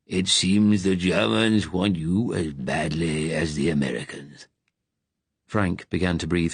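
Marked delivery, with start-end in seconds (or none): 0.1-4.5 slow, level statesman; calm and even, no heat; even Churchill. A narrator's reading. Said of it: "Churchill said evenly".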